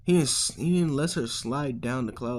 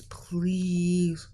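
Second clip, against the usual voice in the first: long, higher-pitched begging please; drawn-out plea; 5 semitones higher than usual. Acted. Aizawa begging Izuku for a cloud, drawn-out plea. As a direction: stretch it out, pleading.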